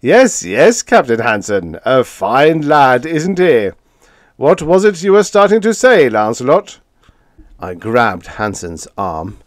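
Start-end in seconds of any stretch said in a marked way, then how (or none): 0.0-6.8 put-on doting, higher and louder old uncle; lightly voiced fond old man; fatuous old man. A narrator's reading. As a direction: beam foolishly over the nephew. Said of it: "The old man smiled fatuously".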